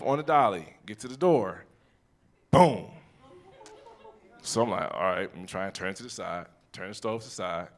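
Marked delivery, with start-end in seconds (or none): 4.9-7.7 quiet muttering to himself. Retold or imitated. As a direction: mutter it under your breath. Muttering while struggling with stove.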